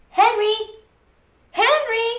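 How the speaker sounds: loud, high call into the dark. A narrator's reading. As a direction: shout his name to find him. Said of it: "Angelina called".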